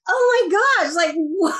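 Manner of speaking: high, squealed "oh my gosh" in retold disbelief; stunned delight. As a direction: replay the jaw-drop of hearing a fan exists. Retelling her stunned reaction to praise.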